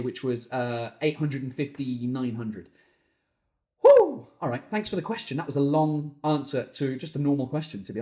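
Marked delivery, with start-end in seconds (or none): 3.8-4.3 high, loud whooped exhale; loud whew. Real, not acted. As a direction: let out a big whew. Catching breath after long answer.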